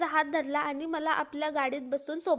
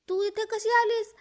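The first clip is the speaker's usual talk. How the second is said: startled, higher and louder question; shocked aunt; 5 semitones higher, 4 dB louder than usual. Acted. Aunt opening the door at one a.m., shocked. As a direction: gasp it out in disbelief.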